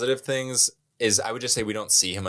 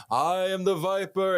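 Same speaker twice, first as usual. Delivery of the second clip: higher, eerie stranger voice; creepy voice; 10 semitones higher than usual. Retold or imitated. Stranger keeps calling while the kid panics, higher eerie voice. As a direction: lift it up as the menace builds. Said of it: "He's like".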